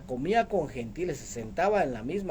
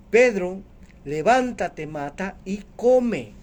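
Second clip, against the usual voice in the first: loud, slowly drawn-out command; commanding heavenly voice; 3 semitones higher, 7 dB louder, slower than usual. Acted. Voice from the vision calls Pedro to eat, loud slow command. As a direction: issue a solemn order, stretching the last words. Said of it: "esa voz que le decía".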